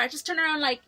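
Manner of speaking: ordinary talk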